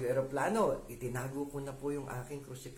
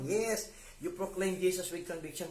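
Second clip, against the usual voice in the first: ordinary talk, nothing marked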